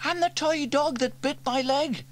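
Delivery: put-on high, bright-eyed girl wondering aloud; young girl Sarah, curious. A narrator's reading. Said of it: "added Sarah".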